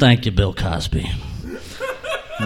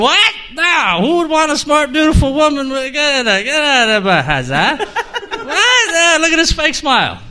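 mock outraged friend; 9 semitones higher than usual. Friend kicking the drunk out, high and indignant. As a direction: throw him out in comic outrage.

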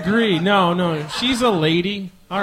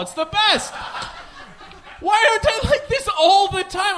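gleeful; 11 semitones higher than usual. Real, not acted.

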